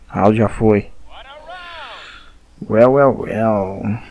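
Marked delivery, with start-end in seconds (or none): 2.7-4.0 drawn-out, sinister well well well; slow villain taunt. Pretend. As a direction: savor each word like a villain. Taunting opponent in English.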